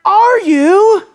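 high, loud accusing cry; shouting high priest. Acted. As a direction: shout it at the accused, each word drawn out. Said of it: "now standing and shouting".

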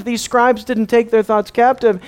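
normal talking voice

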